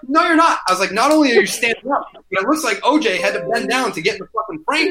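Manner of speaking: high, incredulous retort; mock retort. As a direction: throw it back at him, voice way up. Retold reply to troll.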